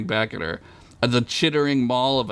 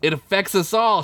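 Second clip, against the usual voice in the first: firm; 8 semitones higher than usual. Acted. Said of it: "she said firmly".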